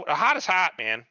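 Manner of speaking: mock-casual hot is hot; matter-of-fact retort. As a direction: state it like it's obvious, man. His quoted retort.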